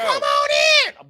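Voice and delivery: very high, loud holler; shrieked comic welcome; mock welcoming shout. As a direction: sing out a hammed-up invitation at the top of the voice. Acts out foolishly inviting vampires in.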